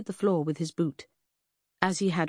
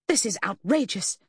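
indignant, raised protest; blustering; 6 semitones higher, 3 dB louder than usual. A narrator's reading. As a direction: bluster with offended outrage. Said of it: "Lucy blustered".

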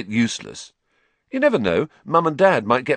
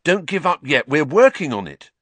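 fierce; 8 semitones higher, 5 dB louder than usual. A narrator's reading. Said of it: "said Ron fiercely".

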